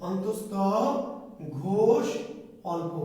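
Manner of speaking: "অন্তস্থ অ... ঘোষ... অল্প" word by word with long pauses; dictation. Shown as a demonstration. Teacher has students write it down, dictating "অন্তস্থ অ, ঘোষ, অল্প" in separate pieces. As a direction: dictate "অন্তস্থ অ, ঘোষ, অল্প", each term held apart. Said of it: "লিখে নেন".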